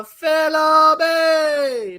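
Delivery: high, loud, very slow name call; booming boxing-ring intro; drawn-out announcer call. Acted.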